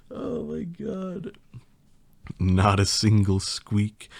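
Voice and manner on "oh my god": high, quiet, slow exclamation; real laughed-out "oh my God"; helpless amused aside. Real, not acted. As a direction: squeak it out, overcome.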